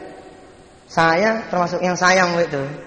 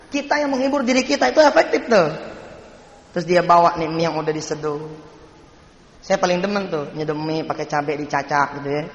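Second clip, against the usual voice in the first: usual speaking voice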